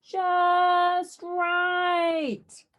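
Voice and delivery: high, loud, drawn-out joyful yell. A narrator's reading. Eugene on the cyclone, long gleeful yell. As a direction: whoop with delight. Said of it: "yelled Eugene".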